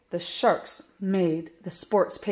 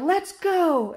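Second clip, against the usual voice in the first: high, raised starting call; coach's shout; 10 semitones higher, 4 dB louder than usual. A narrator's reading. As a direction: call the team into the pool. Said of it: "Coach Blue shouted".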